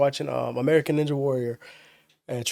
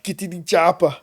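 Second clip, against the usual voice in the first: Terminator impression; 2 semitones higher than usual. Shown as a demonstration. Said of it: "working on a Terminator impression".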